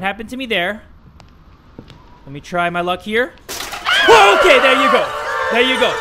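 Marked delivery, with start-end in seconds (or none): 3.5-5.9 high, loud startled shout; startled yelp. Real, not acted. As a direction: jolt with fright then laugh it off. Ghost pops out of locker.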